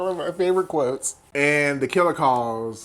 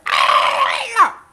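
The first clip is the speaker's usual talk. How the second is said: loud, very high-pitched shriek; hammed-up horror-movie scream; mock scream; 6 dB louder than usual. Sidney seeing the mask, loud mock scream.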